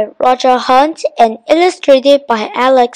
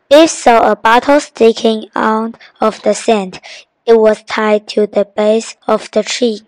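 ordinary delivery, nothing marked

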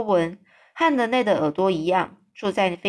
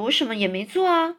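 ordinary delivery, nothing marked

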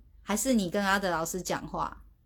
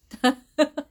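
burst of laughter; 7 semitones higher, 8 dB louder than usual. Real, not acted. Amused at teacher talking late.

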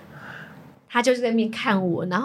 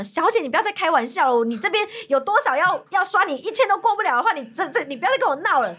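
imitating an exasperated clerk, pitched up; mock a fed-up salesman scolding a customer; retold rude clerk; 7 semitones higher than usual. Male clerk scolding customer over declined cards, imitated rudeness. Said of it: "态度非常的差".

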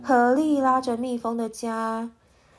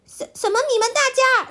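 startled stammer; 12 semitones higher, 5 dB louder, much faster than usual. Acted.

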